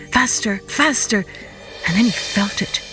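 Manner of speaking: plain reading voice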